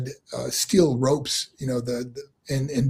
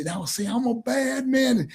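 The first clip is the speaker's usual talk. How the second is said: higher, slow Southern showman drawl; slow swagger like a flamboyant wrestler; imitating Dusty Rhodes; 10 semitones higher, slower than usual. Wrestler imitating his mentor's showy drawl. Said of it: "showed me how to walk and talk and say".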